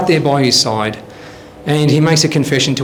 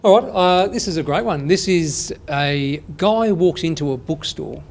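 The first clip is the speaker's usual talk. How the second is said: ordinary delivery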